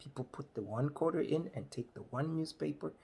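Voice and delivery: ordinary delivery, nothing marked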